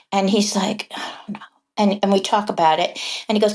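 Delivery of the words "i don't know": very soft, almost all breath; whispered mock admission from a reluctant child; hushed reluctant reply. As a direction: imitate a boy's barely voiced answer. Retells reluctant boy's mumbled answer.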